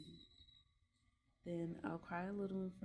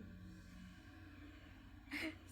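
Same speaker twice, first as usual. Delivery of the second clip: soft laugh; 11 semitones higher, 7 dB quieter than usual. Real, not acted. Laughing at herself.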